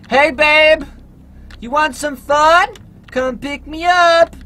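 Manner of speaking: high, loud teasing come-on; mock flirty call. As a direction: lure the driver in playfully. Flirting at passing driver.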